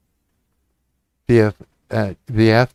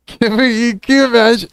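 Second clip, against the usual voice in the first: laughing; 10 semitones higher than usual. Real, not acted. Laughing high.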